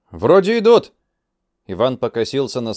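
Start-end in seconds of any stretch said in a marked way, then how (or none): 0.1-0.9 alert call. Acted. Soldier spots people coming.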